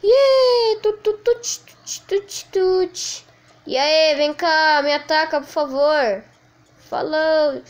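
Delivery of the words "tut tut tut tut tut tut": high, quick tut-tut of a toy gun; imitating gunfire. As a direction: spit out little shots with the mouth. Imita som de tiro no jogo. (imitando tiros agudos com a boca)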